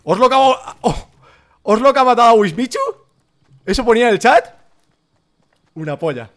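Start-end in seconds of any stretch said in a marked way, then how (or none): 0.0-4.5 high, loud incredulous yell; shocked outburst. Real, not acted. Streamer stunned by a kill on Wismichu, shocked outburst. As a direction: blurt it out loudly in disbelief.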